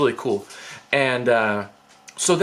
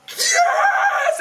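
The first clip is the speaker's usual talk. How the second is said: very high squealed shout, drawn out; ecstatic inner scream. Acted. Boy dared to be kissed celebrates inside, ecstatic. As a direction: squeal it like a silent victory let loose. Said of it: "And inside, I'm just like".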